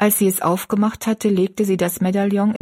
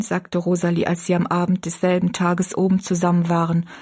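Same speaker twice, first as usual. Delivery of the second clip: plain reading voice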